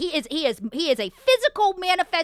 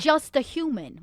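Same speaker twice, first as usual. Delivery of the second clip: usual speaking voice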